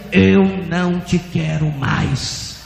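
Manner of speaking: slow, long-drawn refusal; voice of God. Retold or imitated. God answering the kneeling pastor, slow firm refusal. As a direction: toll the words out slowly. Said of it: "E o Senhor dizia".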